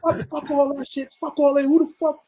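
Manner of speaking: high-pitched angry cursing; imitated high, heated dismissal; getting angrier. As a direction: act out a friend losing his temper. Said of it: "he's getting angrier".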